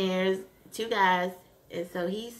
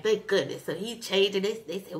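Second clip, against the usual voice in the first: normal talking voice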